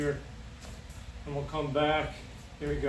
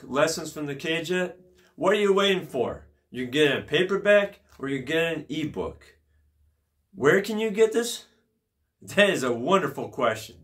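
louder promo pitch for a book; ad read; 7 dB louder than usual. Acted. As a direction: sell it like an ad.

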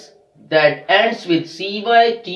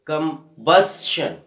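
"combustion" slowed, split before a strong BUS; stress on BUS; slower than usual. Shown as a demonstration. Teacher showing second last syllable rule, slow stressed "comBUStion". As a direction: lean hard on BUS in "combustion". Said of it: "We are stressing on the second last syllable".

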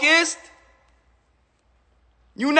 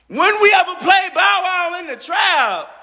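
incredulous shout; 7 semitones higher than usual. Real, not acted. Rapper mocking [name]'s street cred, incredulous shout. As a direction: shout it like the idea is absurd.

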